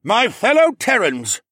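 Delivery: loud, high booming call; booming address. Acted. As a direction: boom across a banquet hall. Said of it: "Gris boomed".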